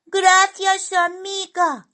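very high, squeaky thanks; high-pitched, cheery gratitude; grateful little character. A narrator's reading. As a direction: thank a friend in a tiny cartoon voice. Simón thanks the magpie.

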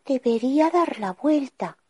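a hint of doubt to herself, up high; princess thinking aloud. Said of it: "pensó".

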